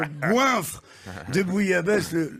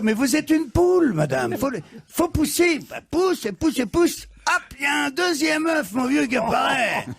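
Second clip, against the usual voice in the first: high, pushing cheer; excited urging; 7 semitones higher than usual. Acted. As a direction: egg her on like a coach at a birth. Rooster urging hen to push.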